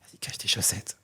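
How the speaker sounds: hushed whisper. Real, not acted. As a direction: whisper it like a secret. Teasing aside about hiding socks.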